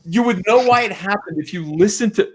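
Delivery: real frustrated yell at the audience; worked-up rant voice. Real, not acted. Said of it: "I'm sorry, I'm yelling".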